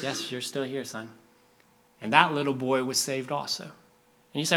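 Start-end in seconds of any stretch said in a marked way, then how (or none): 0.0-1.1 softer, matter-of-fact father; lightly voiced patient dad; reassuring dad. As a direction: quietly confirm it to your son. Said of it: "his dad's like".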